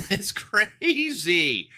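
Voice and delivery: stretched-out amazement. Real, not acted. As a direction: savor the word crazy slowly. Amazed at market highs.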